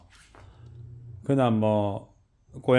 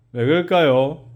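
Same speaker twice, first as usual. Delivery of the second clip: ordinary talk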